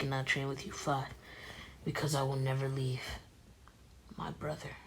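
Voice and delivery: very soft, hushed inner voice; soft, understated vow to a brother; Naruto, earnest and subdued. Acted. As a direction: speak quietly, as a private promise. Plays Naruto musing, then reassuring.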